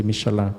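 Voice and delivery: "Michelin" said French-style as mee-shuh-LAN; French pronunciation. Shown as a demonstration. Speaker repeats the corrected tire brand name, French pronunciation. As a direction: close "Michelin" on "la" with the n swallowed. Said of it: "the right pronunciation is actually".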